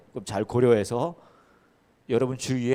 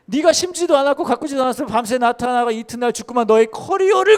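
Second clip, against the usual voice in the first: high, loud preaching climax, building; 10 semitones higher, 9 dB louder than usual. Acted. Pastor applying the verse to the listeners' lives, full-force preaching. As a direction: drive the refrain harder each time. Repeated verse, rising each time.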